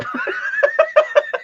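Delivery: high laugh. Real, not acted. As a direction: burst of high-pitched laughter. Laughing at the barker joke.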